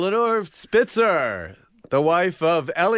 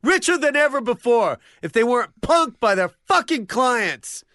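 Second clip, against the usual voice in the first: ranting shout; 7 semitones higher than usual. Acted. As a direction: rant it at full volume. Rant about dentists.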